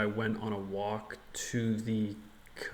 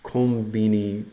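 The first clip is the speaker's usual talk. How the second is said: slow, careful learner Japanese; japanese learner accent; 5 dB louder, slower than usual. Shown as a demonstration. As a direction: sound out each Japanese word carefully. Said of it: "I think I can say".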